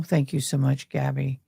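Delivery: ordinary delivery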